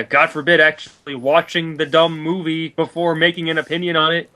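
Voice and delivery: ordinary talk, nothing marked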